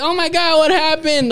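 high mock panic of neighborhood kids; high-pitched imitation of the kids; imitating worried kids. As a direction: squeal it like startled kids. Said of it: "They're like".

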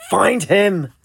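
high-pitched shouted command; twisted rage bursting into an order; enraged shout. A narrator's reading. Lutz orders his mob after the captain, enraged shout. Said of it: "in a twisted rage he shouted".

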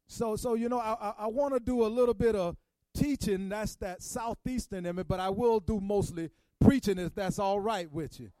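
Good Friday preacher pushing toward the climax; preaching. Acted. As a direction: preach it to the congregation, gathering steam.

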